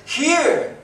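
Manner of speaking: high, loud eager cry; eager answer. Acted. Isaiah answering God eagerly. (masiglang sagot)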